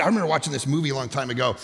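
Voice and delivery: ordinary talk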